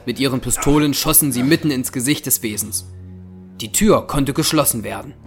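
ordinary talk

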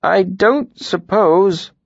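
slow, hesitant tinker; reluctant. A narrator's reading. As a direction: drag it out unwillingly. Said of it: "the tinker said reluctantly".

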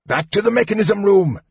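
high-pitched urgent order; cried out. A narrator's reading. Old mage rallying companions to retreat, urgent cry. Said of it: "Fizban cried".

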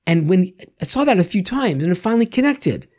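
ordinary talk

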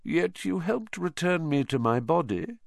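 understated, detached menace; cool villain. Acted. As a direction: state it coolly to your cowering servant. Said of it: "said Voldemort coolly".

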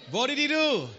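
excited call. Real, not acted. Asks again, much higher.

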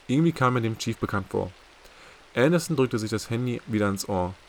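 ordinary delivery

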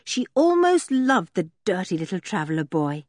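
ordinary delivery

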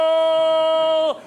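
loud, high drawn-out goal cry; goal shout. Shown as a demonstration. Cameraman demos the goal call loud and high. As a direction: yell it like a stadium announcer.

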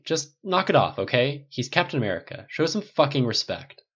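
snapping. Acted. Tony snapping at the stylist to show respect, angry.

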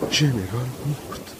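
dazed, involuntary utterance; involuntary blurt. A narrator's reading. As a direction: let it slip out slowly, as if against your will. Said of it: "ورا بی اراده گفت".

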